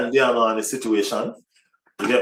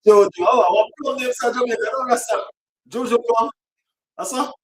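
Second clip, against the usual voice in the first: higher, fast playful calling out; mock caller; 9 semitones higher, faster than usual. Fan playfully calling Jojo over from Jamaica, teasing. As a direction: tease a friend in a sing-song voice.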